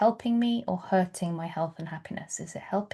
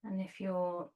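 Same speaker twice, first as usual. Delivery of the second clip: soft, unhurried meditation voice with long pauses; guided meditation; 9 dB quieter than usual. Real, not acted. Yoga teacher settling students onto their bolsters, soft guided meditation. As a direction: ease them gently into stillness, growing quieter.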